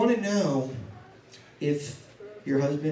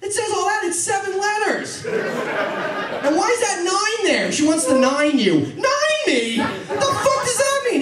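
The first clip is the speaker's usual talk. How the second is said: high-pitched comic exasperation; worked-up, incredulous rant; baffled outburst; 13 semitones higher, 4 dB louder, slower than usual. Acted. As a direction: demand an explanation, voice climbing very high. Baffled outburst at decoded text.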